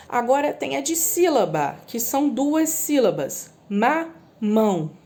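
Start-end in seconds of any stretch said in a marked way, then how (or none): 3.7-4.9 mamão said slowly, syllable by syllable; syllables drawn out. Shown as a demonstration. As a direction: stretch out ma-mão. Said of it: "Pronunciei duas vezes". (mamão bem devagar, sílaba por sílaba)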